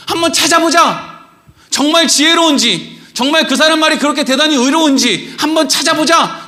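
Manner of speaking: high-pitched, insistent calling-out; Jesus challenging the congregation. Acted. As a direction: search the crowd, demanding they show themselves. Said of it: "예수님이 이렇게 한다고 생각해 보세요".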